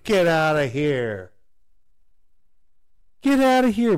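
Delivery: loud, high scornful shout; mocking crowd. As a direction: shout them off in disbelief. Crowd rejecting Jesus's words.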